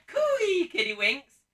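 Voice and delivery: loud, high sing-song "Cooey, Kiddywinks!" with a soaring "Cooey"; panto call. Shown as a demonstration. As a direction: hail the kids across the square, "Cooey" swooping from high. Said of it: "I'm going to shout".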